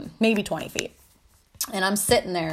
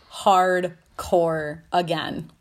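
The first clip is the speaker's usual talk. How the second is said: ordinary delivery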